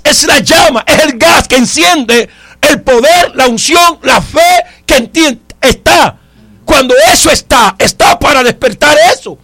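forceful, driving preacher cadence; preaching. Acted. Preacher telling congregation what Satan uses to disturb them, forceful preaching. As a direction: build in repeated waves, stretching the key words.

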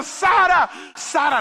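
high, loud cry of a name; shouting. Acted. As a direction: yell for your lost child. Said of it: "empecé a gritar". (gritando angustiado)

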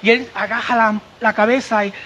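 ordinary talk, nothing marked

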